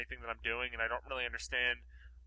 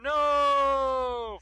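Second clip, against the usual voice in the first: one long, high, held shout; hammed-up drawn-out "nooo"; mock dramatic cry; 11 semitones higher, 8 dB louder than usual. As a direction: wail it loud and drag it out. Hammed-up reading of a name.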